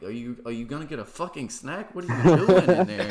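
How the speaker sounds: rising into a loud exasperated shout; frustrated yell. Retold or imitated. To his fiance in the kitchen at night, an exasperated shout.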